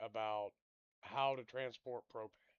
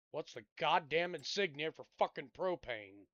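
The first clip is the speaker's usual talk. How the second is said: angry question; 4 semitones higher, 6 dB louder than usual. Real, not acted. Raging question at the game.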